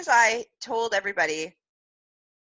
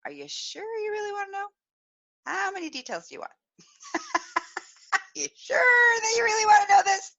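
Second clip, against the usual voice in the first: high, coy and teasing, half laughing; teasing warning; 3 dB louder, faster than usual. Retold or imitated. To the nail girl, warning her, coy high teasing voice. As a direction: tease her with a giggle, daring her to ask. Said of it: "And I'm like".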